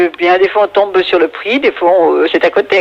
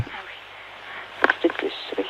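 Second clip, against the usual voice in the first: whispered aside off the phone; whispering; 16 dB quieter, much slower than usual. Real, not acted. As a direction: breathe it to someone beside you. Aside to daughter during call.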